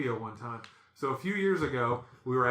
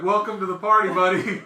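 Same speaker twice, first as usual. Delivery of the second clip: raised, loud mock greeting; loud sarcastic welcome; 5 semitones higher, 10 dB louder than usual. Man to brother-in-law catching on late, loud sarcasm. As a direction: razz a latecomer loudly.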